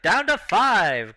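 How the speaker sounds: slow, drawn-out announcer call. Acted. Announcer counting down the contestants, slow drawn-out call. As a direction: savour the count like a ringmaster. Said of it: "He's like".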